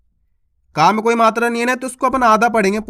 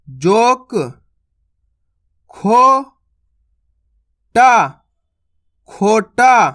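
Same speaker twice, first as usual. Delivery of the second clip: louder, syllable by syllable, then the whole word; syllable drill; 3 dB louder, much slower than usual. Shown as a demonstration. Teacher drilling children aloud on ओ words. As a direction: sound out each syllable and join them. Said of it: "शब्दों को पढ़ते जाना है".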